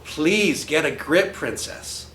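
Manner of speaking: plain reading voice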